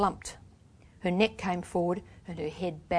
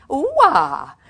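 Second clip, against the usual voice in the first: high, loud trilled taunt; mocking girlish treble; 7 semitones higher, 9 dB louder than usual. A narrator's reading. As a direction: trill it like a little girl, mockingly. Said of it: "in a mocking girlish treble".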